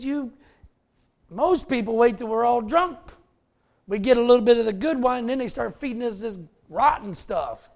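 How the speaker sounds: mock the steward griping about cheap wine; wine steward complaining. Preacher voices wine steward quote.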